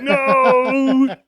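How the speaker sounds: high, loud drawn-out "no"; hammed-up cry of protest; mock panicked shout. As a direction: yell it in comic alarm. Acted panicked reply in a riff.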